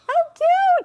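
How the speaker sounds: high, loud squeal of delight; excited squeal. Real, not acted. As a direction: squeal How cute at the buttons. Gushing over pea pod buttons.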